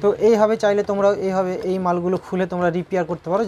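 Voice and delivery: ordinary delivery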